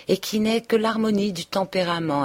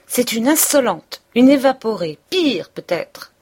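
higher and louder, indignant sputter; sputtering outrage; 6 semitones higher, 6 dB louder than usual. Acted. Mother-in-law fuming to her son, sputtering outrage. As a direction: choke on your own anger. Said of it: "elle balbutiait".

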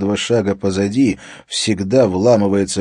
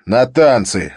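curt retort; 4 semitones higher, 6 dB louder, slower than usual. Acted. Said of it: "отрезал Харри".

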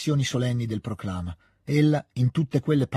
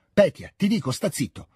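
high, sharp command easing at the end; understated shout to silence a son; shouted order; 7 semitones higher than usual. A narrator's reading. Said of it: "gridò il conte".

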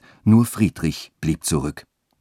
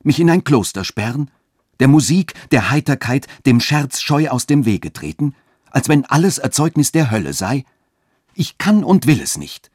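high, raised, affronted questioning; indignant exclamation; 9 semitones higher, 5 dB louder than usual. A narrator's reading. Katharina refuses to shun music and merriment, indignant. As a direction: protest indignantly, pitched high and firm. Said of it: "nicht ohne einigen Unwillen".

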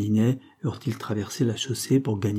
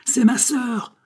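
very high, insistent cry, only moderately louder; full-lunged cry; 13 semitones higher, 4 dB louder, much slower than usual. A narrator's reading. Poet-policeman turning to the crowd as witness, crying out. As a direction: call it out to a whole crowd. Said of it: "cria à plein poumon le poète policier".

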